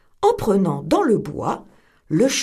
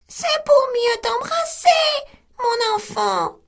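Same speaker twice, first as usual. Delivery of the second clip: much higher, thin put-on granny voice; the wolf squeaks like grandmother; wolf in bed faking the old woman; small voice.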